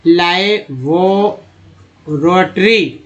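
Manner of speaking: लाइवोरोटरी stretched syllable by syllable; sounded out for learners; very slow sounding out. Shown as a demonstration. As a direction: drag out each syllable of लाइवोरोटरी. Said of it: "teacher sounding out word".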